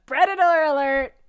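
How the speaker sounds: loud mock alarm call; playful shouted alert; predator alert. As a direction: blare it like a warning siren. Echoing video alarm call loudly.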